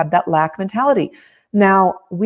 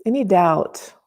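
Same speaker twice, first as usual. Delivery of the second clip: calm, close, unhurried guiding; right in your ear. Acted. Leads a tapping meditation.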